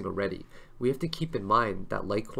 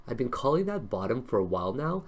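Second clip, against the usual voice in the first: usual speaking voice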